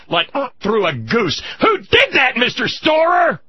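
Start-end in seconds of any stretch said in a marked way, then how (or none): 1.6-3.4 high, loud accusing cry; angry shout. Real, not acted. Host demanding who ran up the debt, furious. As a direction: spit the question at him.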